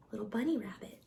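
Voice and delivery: ordinary delivery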